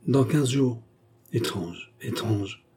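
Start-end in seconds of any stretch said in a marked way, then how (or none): 1.3-1.9 a low, soft murmur to himself. A narrator's reading. Count murmuring alone in his study, low and soft. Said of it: "murmura monsieur de Kergaz". 2.0-2.6 soft repeated murmur, falling away. A narrator's reading. Count repeating it to himself, soft falling murmur. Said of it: "murmura monsieur de Kergaz".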